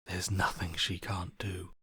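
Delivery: an awed whisper; hushed, reverent whisper. Acted. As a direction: breathe it in reverence. Whispered awe.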